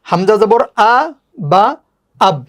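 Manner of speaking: "হামজা জবর আ" held, then "বা" alone, then "আব"; second spelling method. Shown as a demonstration. Teacher repeats the second way for learners, "আ", "বা", "আব" in steps. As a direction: spell it piece by piece with pauses before "বা" and "আব". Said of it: "আরেকভাবে হচ্ছে".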